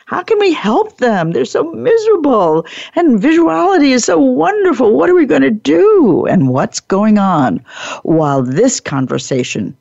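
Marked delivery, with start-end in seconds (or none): 0.1-6.2 higher, anxious do-gooder voice; mock worried teammate, pitch climbing; fretting rower. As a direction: play the rower wringing hands over the grumblers. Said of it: "You have another meeting with the rowers".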